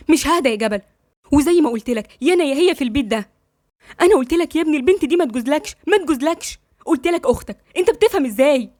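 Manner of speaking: indignant mother, voice climbing as she goes; angry scolding. Acted. Mother forbidding her son the girl, angry and insistent.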